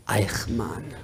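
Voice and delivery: a hushed, ominous reveal; whispered name. Retold or imitated. Son names his father.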